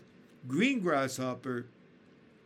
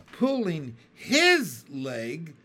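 gleeful cry; 3 semitones higher, 9 dB louder, much slower than usual. A narrator's reading. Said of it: "he cried".

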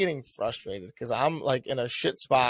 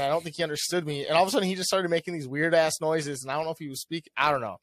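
normal talking voice

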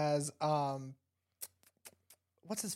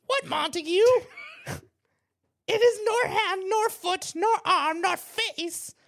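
put-on high, loud old hag voice; 7 dB louder than usual. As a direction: play Juliet as a cackling old crone. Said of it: "I just wanted to sound like an old hag".

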